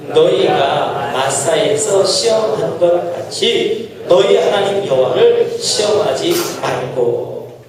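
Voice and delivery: slow unison reading; scripture reading. Acted. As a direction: read the verse together, measured. Congregation reads scripture aloud.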